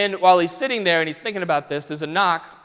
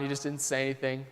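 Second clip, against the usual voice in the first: ordinary talk, nothing marked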